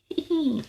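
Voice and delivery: high burst of laughter; delighted laugh. Real, not acted. Reader laughing as the robot wipes out, high delighted laugh.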